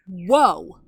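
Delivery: loud, heavy woe; intense woe. Retold or imitated. Jesus pronouncing woe, loud and intense. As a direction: drop the word like a warning. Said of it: "when Jesus would say".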